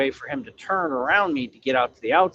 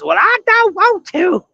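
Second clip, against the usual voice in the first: whiny mock voice; 7 dB louder than usual. Mocking the trucker who won't move, high whining voice. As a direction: whine it like a stubborn trucker. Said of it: "He says".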